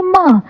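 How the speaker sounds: "म" as an m sound with short vowel; letter sound demo. Shown as a demonstration. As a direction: sound out "म" for learners. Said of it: "एम की sound होती है".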